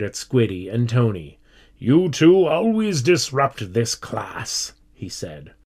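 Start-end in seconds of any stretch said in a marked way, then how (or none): 1.8-4.7 slow, raised-pitch teacher's reprimand; stern scolding. A narrator's reading. Teacher glaring at Squiddy and Tony, sternly scolding. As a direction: tell them off slowly and firmly.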